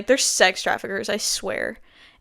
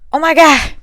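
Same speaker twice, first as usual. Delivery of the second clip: surprised; 7 semitones higher, 9 dB louder than usual. Real, not acted. Reacts to an incoming text.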